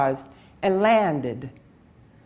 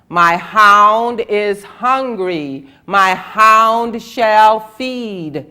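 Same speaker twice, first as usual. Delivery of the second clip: slow, loud, louder demand; stern loud demand; 3 semitones higher, 9 dB louder, slower than usual. A narrator's reading. Shakra demands the city feed his hound, slow loud demand. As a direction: press the city harder, each word slow. God demands food again.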